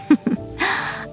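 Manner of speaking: soft airless laugh; breathy giggle. Acted. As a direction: giggle quietly to yourself. Amused at fireworks uproar.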